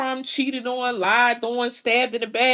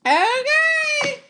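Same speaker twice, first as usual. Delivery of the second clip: excited; 14 semitones higher than usual. Real, not acted. Drawn-out exclamation.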